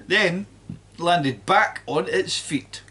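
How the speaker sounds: usual speaking voice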